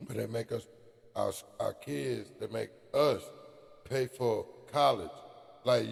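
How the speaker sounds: normal talking voice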